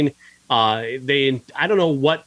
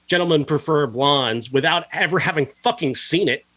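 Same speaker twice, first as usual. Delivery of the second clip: ordinary talk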